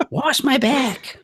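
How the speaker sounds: very high, slow, drawn-out mother screech; screeching mom voice. Retold or imitated. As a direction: stretch it out like a demanding sitcom mom. Sitcom mother voice continues.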